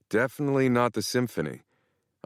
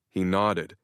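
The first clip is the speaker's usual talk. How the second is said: usual speaking voice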